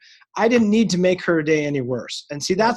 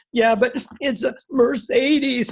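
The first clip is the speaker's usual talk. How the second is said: drawn-out, higher-pitched tearful wail; mock sobbing; 7 semitones higher, 3 dB louder, slower than usual. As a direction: imitate a sobbing teenage driver, slowly. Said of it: "she just continues to sob".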